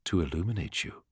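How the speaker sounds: low, quiet remembered words; cold and distant. A narrator's reading.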